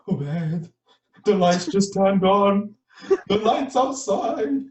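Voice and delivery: mate's frightened high, slow report of lights; drawn-out spooked voice; scared whisper. Retold or imitated. Mate waking his friend at night, frightened and slow.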